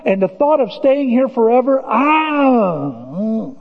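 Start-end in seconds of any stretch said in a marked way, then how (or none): 1.8-3.5 drawn-out weary groan. Real, not acted. Pastor groaning at the thought of living here forever. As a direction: let out a tired ah.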